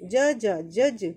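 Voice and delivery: জজ slowly and loud, the first জ half and the second full; doubled letter demo. Shown as a demonstration. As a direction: drill জজ slowly. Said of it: "তারপরে পুরো কা আছে". (জজ ধীরে, প্রথমটা হাফ)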